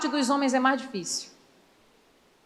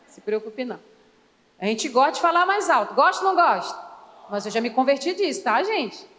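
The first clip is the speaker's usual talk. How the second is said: normal talking voice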